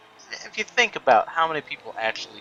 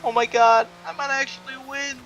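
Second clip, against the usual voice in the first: very high-pitched, thrilled mock squeal; hammed-up excitement; 6 dB louder than usual. Gamer thinks he might win, hammed-up high excitement. Hamming up a possible race win.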